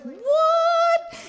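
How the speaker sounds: high, loud sung-out what; amazed. Retold or imitated. Woman stunned by boyfriend's sudden singing, high and loud. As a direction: shriek it in delighted disbelief. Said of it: "And I was like".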